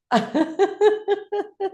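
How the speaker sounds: high burst of laughter; high rattling laugh; exercise laughter. Shown as a demonstration. Laughter exercise, oxygen mask.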